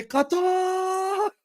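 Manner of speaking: very high, stretched-out exclamation; commentator's drawn-out cry. Acted. Host echoing famous corner taken quickly call, mock-excited cry. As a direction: hold the word in a soaring mock commentary yell. Reads chat's parody of commentary catchphrase.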